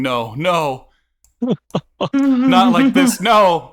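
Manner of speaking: high, loud anguished wail; a secretary breaking down in a loud high wail; despairing wail. Acted. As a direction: wail it out, clutching your head. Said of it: "clutches their head going".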